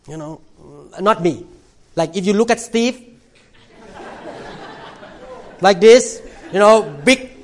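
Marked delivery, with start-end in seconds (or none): 5.6-7.3 puffed-up strongman voice, louder; tough guy swagger. Pretend. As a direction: swagger like a muscle man. Mimics muscular strong men.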